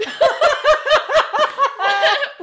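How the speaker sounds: bursting into a high, loud laugh. Real, not acted. Laughs at own dislike of book.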